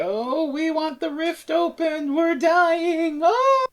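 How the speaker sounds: high, slow, singsong ghostly moan rising to a howl; zombie wail. Retold or imitated. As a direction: moan like wailing undead. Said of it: "they say".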